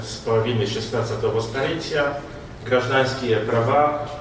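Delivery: ordinary talk, nothing marked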